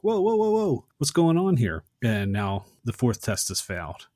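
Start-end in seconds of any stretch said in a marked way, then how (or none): 0.0-1.8 much higher, louder, startled whoas; startled and scared. Retold or imitated. Max seeing his reflection in the mirror, hammed-up startled panic. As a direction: jump back from the mirror in mock fright. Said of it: "jumps back scared".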